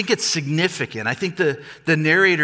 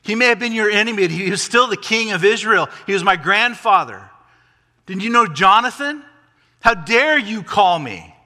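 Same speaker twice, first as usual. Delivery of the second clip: loud, higher, affronted protest; indignant entitlement; 7 semitones higher, 6 dB louder than usual. Acted. Grandson demanding respect from the king, indignant. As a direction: act out an entitled grandson taking offence. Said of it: "claiming entitlement".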